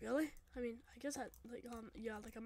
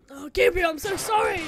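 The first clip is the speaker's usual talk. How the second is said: loud, higher-pitched outburst of remorse; distraught apology; 4 semitones higher, 12 dB louder than usual. Acted. Apologising to Gabriel, distraught. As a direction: cry out an anguished apology.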